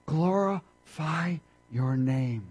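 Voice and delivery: drawn out, very slow plea; slow prayerful plea. Acted. Jesus praying to the Father, slow and drawn out. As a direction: stretch glorify into a prayer.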